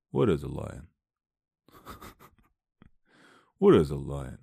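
low, slurred mock tough-guy voice; Sylvester Stallone impression. Actor at the mirror, low Stallone impression. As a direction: play a puzzled actor, pitched low. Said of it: "I don't know why he sounds like Sylvester Stallone".

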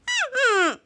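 high closed-mouth hum; muffled. A narrator's reading. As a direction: speak as if gagged.

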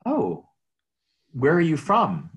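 high, loud, eager opener; bright friendly greeting. Retold or imitated. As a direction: greet strangers with upbeat curiosity. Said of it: "My typical opening line".

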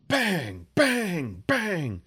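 three loud high bangs, each falling; series of bangs. A narrator's reading. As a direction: hammer out each impact. Rocks hit in a series.

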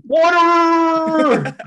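all-caps comment shouted out; caps lock yell. Retold or imitated. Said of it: "like, caps lock".

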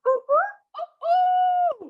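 very high squealing ooh; excited squeal. Retold or imitated. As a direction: squeal with delight. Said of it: "I was like".

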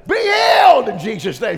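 loud, high healing shout; screaming. Retold or imitated. Pastor's wife screaming healing as ushers drag the lady. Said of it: "She's screaming at the top of it".

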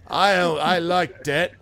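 put-on slow, high, loud drunk voice; a drunk patron mishearing the word, slow and loud. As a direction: slur it out like a confused barfly.

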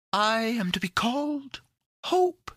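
high, cheery little fairy voice; sunshiny creature. A narrator's reading. Hope introduces herself to Pandora, sunny and high. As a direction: announce it sweetly, very high. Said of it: "answered the sunshiny creature".

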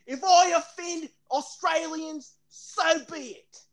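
high-pitched, hammed-up Australian accent; [name]. Defending himself for offending Australians, exaggerated Australian accent. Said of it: "and I'm from Australia".

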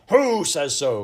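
loud, high indignant outburst; angry cry. A narrator's reading. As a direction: explode in offended protest. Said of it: "cried the gentleman angrily".

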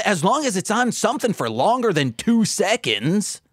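high-pitched mock complaint; acted exasperation. Acted complaint about changing channel.

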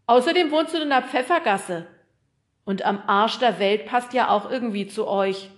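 loud, high-pitched postman's voice; chatty postman. Acted. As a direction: speak up as a talkative postman.

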